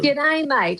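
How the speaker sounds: Australian woman playing up her own accent to the host; broad Aussie greeting. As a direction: lay on the Australian accent for "G'day, mate". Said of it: "I need to sound more Aussie".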